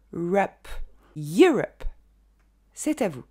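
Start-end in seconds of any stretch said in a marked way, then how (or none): 0.1-0.7 rup lâché en une syllabe très brève; son très court. Shown as a demonstration. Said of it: "c'est un son très court". 1.2-1.8 Europe à l'anglaise, YOU fort puis rup très court; accent sur YOU. Shown as a demonstration. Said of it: "l'accent tonique est sur le you".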